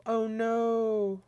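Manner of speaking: high, drawn-out "oh no"; Sniper Wolf. Acted. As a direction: lightly imitate a softly held, high-pitched wail. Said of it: "Like Sniper Wolf".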